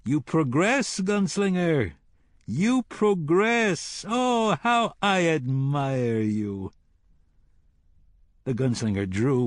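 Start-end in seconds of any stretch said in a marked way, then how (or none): 0.0-1.9 taunting praise. A narrator's reading. 2.4-4.0 high, very slow, gloating repeat of "progress"; mocking admiration, savouring it; drawn-out mock praise. A narrator's reading. 4.1-6.7 high then very slow gloating sigh; villain's sarcastic adoration; mock admiration. A narrator's reading.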